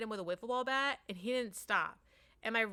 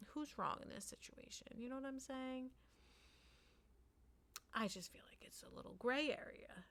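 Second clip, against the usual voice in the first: soft, whispered confiding; whispered aside; 4 semitones higher, 10 dB quieter than usual. Real, not acted. Dad confiding his doubt to viewers, whispered. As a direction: lean in and whisper it.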